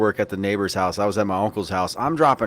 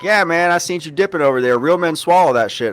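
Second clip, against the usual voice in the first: raised, louder taunting neighbor voice; mocking the neighbor; 6 semitones higher, 4 dB louder than usual. Neighbor taunting him about swallowing, hammed-up jeer. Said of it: "And he's like".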